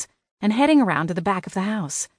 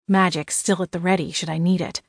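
usual speaking voice

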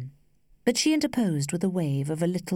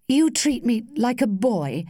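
mildly bitter outburst, raised in pitch and level; youthful bitterness; 6 semitones higher, 5 dB louder than usual. A narrator's reading. Young suitor protests to the lady, mildly bitter. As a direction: break out with a touch of boyish resentment. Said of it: "he broke out with youthful bitterness".

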